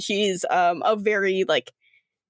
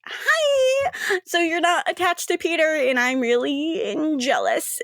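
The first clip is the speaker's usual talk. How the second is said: flirty Rochelle; 7 semitones higher than usual. Acted.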